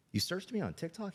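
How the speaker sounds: retold high-pitched disbelief; astonished father, imitated; incredulous dad. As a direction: question it with the voice shooting up.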